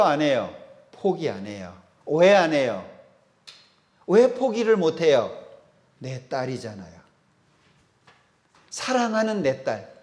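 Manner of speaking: normal talking voice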